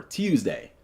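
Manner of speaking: "Tuesday" with the y glide, "Tyoozday"; quiet proper "Tuesday"; soft, careful "Tuesday" with a full "day". Shown as a demonstration. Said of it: "that sounds proper".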